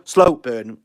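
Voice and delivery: ordinary talk, nothing marked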